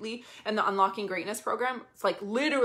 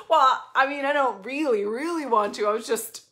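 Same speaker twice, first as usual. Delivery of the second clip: whiny past self; 4 semitones higher, 6 dB louder than usual. Retold or imitated. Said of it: "And then I was like".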